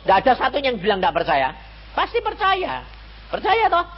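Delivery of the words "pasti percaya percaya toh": high, teasing sing-song; mock certainty. Preacher teasing the congregation, high mock certainty. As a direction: poke fun at easy faith. Mocking sure believers.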